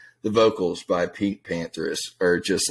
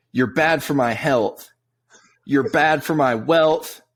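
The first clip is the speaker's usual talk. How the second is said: high, loud, slow sneering recital of "bad for my health" and "bad for my wealth"; mocking lyric reading; 7 semitones higher, 3 dB louder, slower than usual. Friend ridicules the lyrics to his co-host, drawn-out mock singsong. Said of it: "you can't read lyrics like that".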